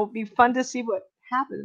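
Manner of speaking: normal talking voice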